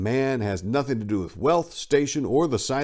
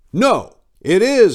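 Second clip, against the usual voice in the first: a sudden loud, higher "No!" and "It is"; emphatic no; 11 semitones higher, 10 dB louder, slower than usual. Real, not acted. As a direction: slam down the answer hard. Emphatic rebuttal in memoir reading.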